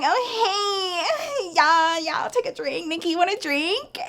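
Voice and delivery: high, giggly mock come-on; flirty friend act. Retelling a man offering drinks to the friends, high mock flirty. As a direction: put on a high, sing-out invitation.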